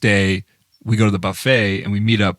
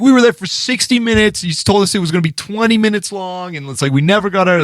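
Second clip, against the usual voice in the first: imitating angry complaint; 9 semitones higher than usual. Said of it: "he was just so mad about it".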